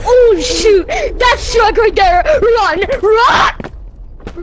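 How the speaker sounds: gasp, then loud high cry; panicked shouting. Real, not acted. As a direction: scream to run in panic. Panics at the truck.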